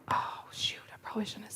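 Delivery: whispered inner panic; hushed, alarmed inner thought; panicked whisper. Retold or imitated. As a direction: mutter it to yourself in alarm. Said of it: "I'm kind of freaking out".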